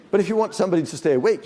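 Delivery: quick, energized delivery to keep listeners awake; rousing wake-up voice. Shown as a demonstration. Trainer shows how to keep somebody awake, brisk and lively. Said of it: "you do like this".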